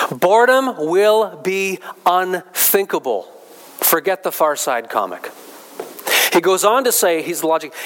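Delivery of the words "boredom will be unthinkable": BOREDOM and UNTHINKABLE drawn out, higher and firm; slow, raised, weighty emphasis on every word; slow emphatic repeat. Real, not acted. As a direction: hammer home "Boredom will be unthinkable", word by word. Repeats quoted claim slowly for emphasis.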